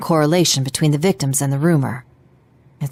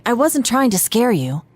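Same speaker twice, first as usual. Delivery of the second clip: ordinary talk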